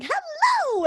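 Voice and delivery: high, loud sing-out hello; enthusiastic show greeting. Retold or imitated. Host demonstrating the morning hello fans hear, enthusiastic. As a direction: belt out a big podcast hello.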